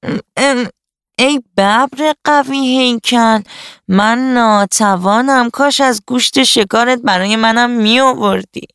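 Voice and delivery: butter him up in a high jackal voice; fawning flattery. A narrator's reading. Jackal flattering the tiger for meat, high fawning voice. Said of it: "با چاپلوسی گفت".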